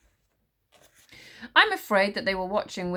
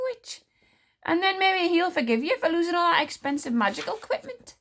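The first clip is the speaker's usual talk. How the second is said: louder, higher sly advice; sweetly twist the knife; mock-helpful queen; 10 semitones higher, 5 dB louder than usual. A narrator's reading. Queen advising the witch smeller, sly. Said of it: "in a tone of gentle surprise".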